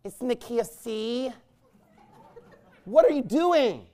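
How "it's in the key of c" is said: softer mock voice; lightly voiced choir director, softened; a hint of a prissy choir director; mock effeminate choir director. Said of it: "You talk to the male piano player".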